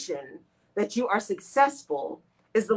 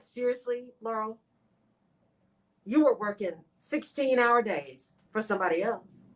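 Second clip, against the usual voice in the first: scolding herself. Retold or imitated. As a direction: berate yourself, rising to disbelief at the end. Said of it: "I have those days where I'm like".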